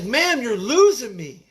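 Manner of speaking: retold desperate plea, lightly acted; pleading. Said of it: "And I was like".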